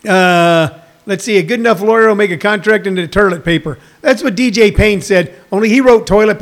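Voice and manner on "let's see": ordinary talk, nothing marked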